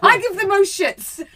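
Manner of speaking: high, loud, slowing boast; high mock boast. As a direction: outdo them playfully.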